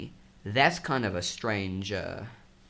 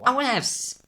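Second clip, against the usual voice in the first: high, louder mock demand; mock self-important; 12 semitones higher, 5 dB louder than usual. Voicing someone who wants their own accent, mock self-important. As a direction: pipe up insistently, pitched way up.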